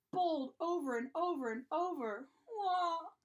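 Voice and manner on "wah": acted cry of a hurt little one; yelled baby wail. A narrator's reading. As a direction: bawl like a toddler hit in the tummy. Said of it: "he yelled".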